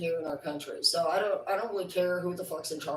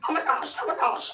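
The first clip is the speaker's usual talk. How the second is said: mock panic; 8 semitones higher, 6 dB louder, faster than usual. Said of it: "You're like".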